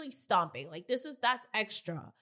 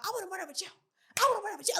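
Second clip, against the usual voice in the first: mocking hype voice; 9 semitones higher, much faster than usual. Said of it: "talking about".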